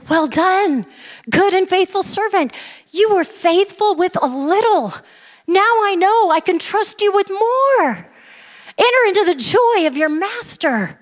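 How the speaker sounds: high, ringing commendation; master's praise. Retold or imitated. Master to his two faithful servants, exultant acted praise. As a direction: proclaim it up high, drawing out the ends. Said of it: "the master with the first two".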